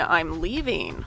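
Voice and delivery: ordinary talk, nothing marked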